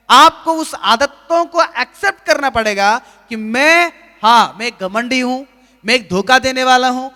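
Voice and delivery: loud, rising preacher's call to confess; fervent sermon delivery; preaching at full force. Acted. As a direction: drive each line home to the congregation. Preacher builds confession list.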